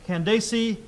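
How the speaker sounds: Candace said as can-DAY-see; alternative pronunciation. Shown as a demonstration. Preacher shows a variant he has heard, Candace as can-DAY-see. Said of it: "I've also heard".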